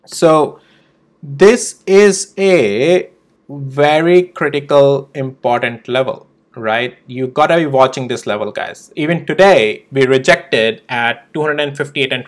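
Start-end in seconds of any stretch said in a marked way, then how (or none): 1.2-6.2 slow, drawn-out stress on "very critical"; real emphasis. Real, not acted. Trader warning viewers about a critical price level, slow and emphatic. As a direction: hammer home "very critical, important level", word by word. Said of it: "I'm emphasizing it quite hard".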